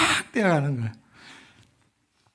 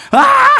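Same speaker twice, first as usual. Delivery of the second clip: piercing high yell, much louder, tipping into a laugh; scared yell; 15 dB louder than usual. Retold or imitated. Pastor imitating his childhood scream, high loud yell. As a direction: cry out as if fleeing a ghost. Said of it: "그러면서 막 뛰어가요".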